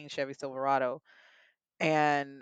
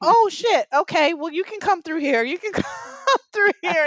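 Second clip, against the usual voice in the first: imitating sudden panic, very high and loud; panicked hospital worker; 10 dB louder than usual. Man grabbed mid-contraction giving way, hammed-up panic. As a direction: yelp it in alarm, laughing by the end. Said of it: "I grab him in the middle of a contraction".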